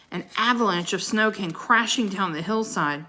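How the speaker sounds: plain reading voice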